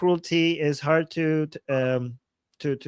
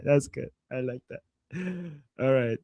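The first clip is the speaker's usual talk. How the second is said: ordinary talk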